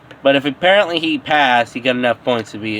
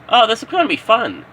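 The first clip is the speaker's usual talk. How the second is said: higher, quick excited classmate; eager; 8 semitones higher, faster than usual. Acted. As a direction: bubble over with excitement. Classmate eager before tests.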